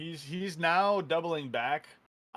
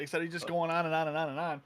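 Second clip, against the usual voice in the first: normal talking voice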